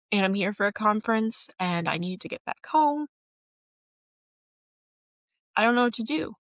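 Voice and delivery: retold tearful plea. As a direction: beg a stranger for help while near tears. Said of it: "Like, I literally was crying".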